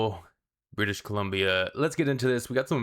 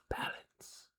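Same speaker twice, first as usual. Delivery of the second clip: barely audible whispered mantra; faint whisper; 15 dB quieter than usual. Pretend. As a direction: let it fade to a breath. Mock mantra fading out.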